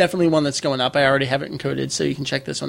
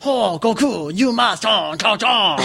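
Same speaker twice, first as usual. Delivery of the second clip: exaggerated mock Chinese accent, higher pitched; mock Chinese old man; 4 semitones higher, slower than usual. Mimicking the commercial's narrator to co-host, mock Chinese old man. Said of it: "Chinese old man voice".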